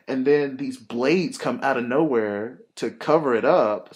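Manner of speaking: ordinary talk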